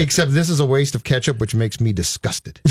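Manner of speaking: normal talking voice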